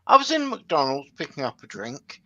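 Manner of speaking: normal talking voice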